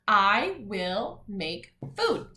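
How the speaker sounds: slow, one word at a time; measured word-by-word classroom reading; read-along. Shown as a demonstration. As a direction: lead children through each word slowly. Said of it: "let's read our words".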